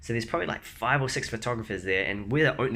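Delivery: normal talking voice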